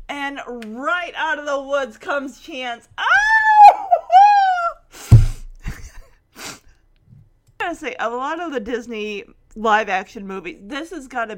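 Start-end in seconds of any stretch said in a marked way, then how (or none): 3.0-6.0 very high, loud drawn-out "oh"; excited scream. Real, not acted. Fan relives Chance coming out of the woods, excited scream. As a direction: squeal it out, breaking into laughter.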